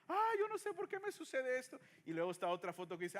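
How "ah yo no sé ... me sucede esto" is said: put-on high, whiny voice of a crying baby. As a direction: whimper it like a confused baby. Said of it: "un bebé que está llorando y dice". (voz aguda y quejumbrosa de bebé llorando)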